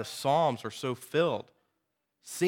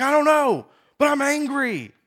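much higher, loud blurted angry retort; angry teen; 11 semitones higher, 9 dB louder than usual. Retold or imitated. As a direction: shout it like a sullen teenager. The youth answers back.

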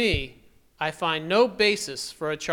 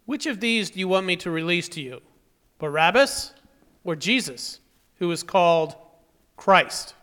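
loud public question over an uproar; calling out; 3 semitones higher, 8 dB louder than usual. Acted. Pilate offering an uproarious crowd a choice, calling out.

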